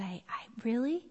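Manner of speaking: a stunned, unvoiced little whisper; startled whisper. Mother recalling her reply to her son, whispered. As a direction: breathe it out in disbelief.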